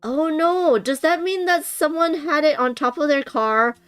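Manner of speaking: high-pitched dismay; dismayed reaction. Real, not acted. Reacting to cards lost on road.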